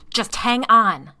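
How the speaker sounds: slow, loud growl; man warning his wife, slow growl. Acted. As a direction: growl it through the tension. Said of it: "Tom growled".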